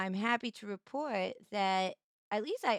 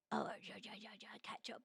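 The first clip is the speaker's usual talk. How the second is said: breathy whisper to herself; 17 dB quieter, faster than usual. Real, not acted. Reading chat, whispering to herself. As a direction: whisper "ja, ja, catch up" under the breath.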